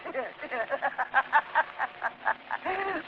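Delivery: soft mocking maniac cackle; mad laugh. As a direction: chuckle at him like a madman. Madman laughs at forgetful shadow.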